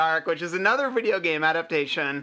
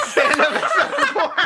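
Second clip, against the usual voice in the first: laughing; 14 semitones higher, 6 dB louder than usual. Real, not acted. Laughing at the repeated title.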